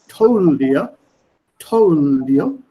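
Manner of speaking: told you in an Indian English accent. Shown as a demonstration. Teacher demonstrating an Indian English accent. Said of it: "That's Indian English".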